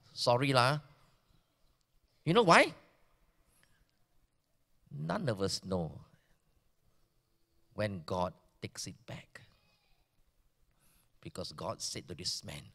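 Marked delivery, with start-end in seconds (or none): 4.9-12.6 whisper. Real, not acted. Preacher to his congregation, a hushed whisper.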